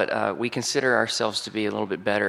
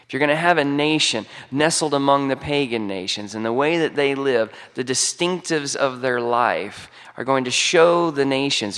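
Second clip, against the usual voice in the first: ordinary delivery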